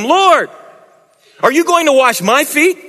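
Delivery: Peter, startled, pleading with Jesus; high, indignant disciple asking his master; Peter's voice. Acted. Preacher voices Peter in the scripture.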